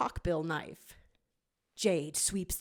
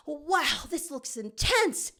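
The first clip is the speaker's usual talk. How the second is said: raised voice, impressed; higher young fox; 7 semitones higher, slower than usual. A narrator's reading. Tails sees the void, higher and impressed. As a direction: lift the pitch, wide-eyed. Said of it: "Tails says".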